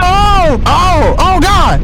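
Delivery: high loud panicked oh; alarmed at the flames; panicked cries. Acted. Fire flares up.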